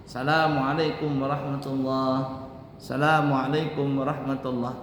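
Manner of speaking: Assalamualaikum said as salamualaikum; dropped syllable. Shown as a demonstration. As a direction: clip off the opening as. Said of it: "Tidak sah seperti itu".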